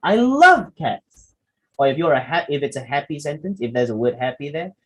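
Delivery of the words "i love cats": sincere slow loving "I love cats"; tender adoration. Shown as a demonstration. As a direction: say it meaning every word. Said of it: "Say it in a loving way".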